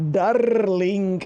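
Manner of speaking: hammed-up French accent with rolled R; exaggerated, badly faked French; attempted French accent. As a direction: try a clumsy French accent, rolling the R. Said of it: "I can't do accents".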